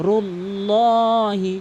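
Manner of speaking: রুল্লাহি with a long, full "lo". Shown as a demonstration. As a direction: slowly stretch a dark "lo" in রুল্লাহি. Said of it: "এখানে হবে রুল্লাহি".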